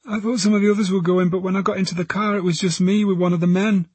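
put-on high, anxious girl's voice; frightened teenage girl. Girl tells detectives about the car, frightened. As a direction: tell it in a high young voice.

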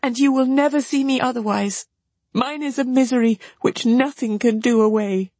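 high voice of misery; despairing sister. A narrator's reading. Marianne despairs, acted.

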